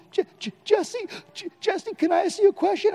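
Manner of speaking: high, stammering, frightened tough guy; cracking scared voice. Retold or imitated. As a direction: squeak his name out like a scared ex-con. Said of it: "His voice sounded like he was an opera singer".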